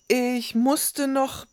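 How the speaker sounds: high, slow, drawn-out start of an excuse; boy starting an excuse. A narrator's reading. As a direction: begin explaining slowly, then get cut off. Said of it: "fiel ihm ins Wort". (zögernde Ausrede, hohe Jungenstimme)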